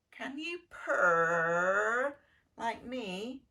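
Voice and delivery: slow, drawn-out, loud purring cat voice; smug cat. Acted. Cat teasing the duckling, slow purring boast. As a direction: stretch each word like a lazy cat. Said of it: "asked the cat".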